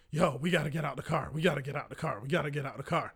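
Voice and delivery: low, soft repeated urging; hushed urging. Retold or imitated. Passenger re-enacting urging his dazed friend out, hushed and insistent. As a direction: urge it under your breath, over and over.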